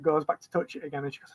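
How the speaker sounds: normal talking voice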